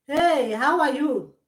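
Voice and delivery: loud, high, drawn-out hello; big exaggerated greeting; acted greeting. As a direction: call out a greeting slowly and loudly. Acting out someone reaching out.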